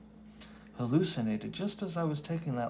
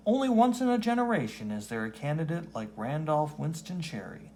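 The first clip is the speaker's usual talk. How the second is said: campaign ad read; 5 semitones higher than usual. Acted. Reading a mayoral campaign ad in the comic, booming announcer voice. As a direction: sell the candidate like a TV spot.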